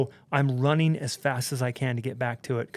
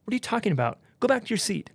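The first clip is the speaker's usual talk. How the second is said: higher-pitched mock scolding; dismissive scolding; 8 semitones higher, faster than usual. Crew to panicked passenger, dismissive scolding. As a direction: imitate crew brushing off a passenger.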